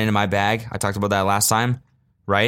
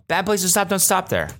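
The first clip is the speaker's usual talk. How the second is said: scolding line; 9 semitones higher than usual. Shown as a demonstration. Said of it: "Just say that".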